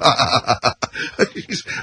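smug mock executive laugh. Nike exec laughing at the host, smug mock laugh. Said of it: "They're laughing".

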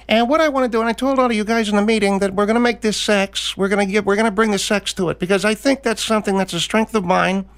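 very high squeaky upset singer; squeaky Chris Poutet. Pretend. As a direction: squeal it like a fussy little crooner. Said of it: "Chris Poutet is changing his act".

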